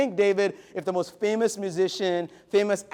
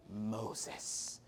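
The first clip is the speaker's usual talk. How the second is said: hushed reveal; 8 semitones lower, 18 dB quieter than usual. Acted. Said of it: "dramatic reveal".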